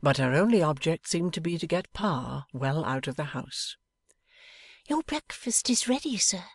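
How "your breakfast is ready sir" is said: put-on higher, whispered young woman; whispering Bella. A narrator's reading. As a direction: hush it like an excited girl. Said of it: "whispered Bella".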